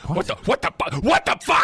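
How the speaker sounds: outraged disbelief. Retold or imitated. Bar patron reacting to a slur, building outrage. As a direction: start stunned, erupt on the repeat. Snapping at a racist remark.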